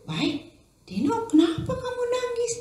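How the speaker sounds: high, worried mother's voice; a startled mum asking her son what's wrong; surprised mother. Acted. Mother asking her crying son why he cried, high and concerned. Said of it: "Weh, maminya kaget".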